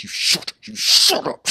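hammed-up parent scolding a screaming kid; mock angry scolding. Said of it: "we're both just like".

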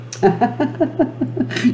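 an airy, high demonstration laugh; demo laugh. Shown as a demonstration. Host laughs to get viewers laughing. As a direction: laugh first so they join in. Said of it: "I command you to laugh".

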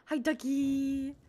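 loud, drawn-out greeting; calling out. Pretend. Player greeting Ducky, calling out long and loud. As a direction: stretch the hello out loudly.

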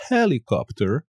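"helicopter" sin aspirar la h inicial. Shown as a demonstration. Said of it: "the H is never, ever pronounced". ("helicopter" sin la h)

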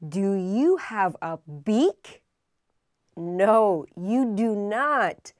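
ordinary talk

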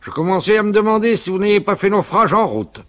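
loud, high, hearty teasing. Acted. Uncle Jules teasing the late father, loud and hearty. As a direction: boom it out jovially. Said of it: "Il parlait d'une voix forte".

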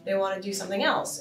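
ordinary talk, nothing marked

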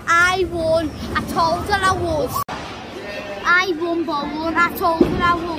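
loud, proud victory boast; real loud bragging over his mum; triumphant gloating. Real, not acted. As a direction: crow about winning to the camera. Boy gloating after winning bowling.

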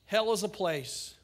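ordinary talk, nothing marked

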